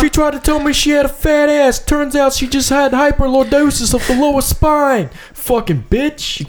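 high, strained mock Jersey rant; hammed-up Jersey accent. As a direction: rant like an indignant Jersey tough guy. Continues the Jersey tough-guy bit.

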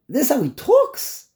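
high-pitched, scandalized question; incredulous indignation. Real, not acted. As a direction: question it in sheer disbelief.

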